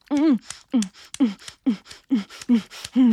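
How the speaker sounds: loud hummed moans. Acted. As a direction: moan loudly as it builds. Moaning building to climax.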